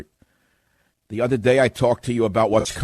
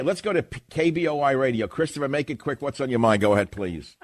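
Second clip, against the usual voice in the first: usual speaking voice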